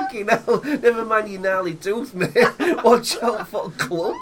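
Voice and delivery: laughing. Real, not acted. Laughing mock horror.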